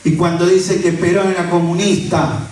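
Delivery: ordinary delivery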